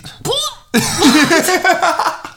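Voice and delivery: bright burst of laughter at her partner; real laugh. Real, not acted. Cracking up at her fiancé's Hamilton joke, laughing. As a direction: laugh out loud, caught off guard.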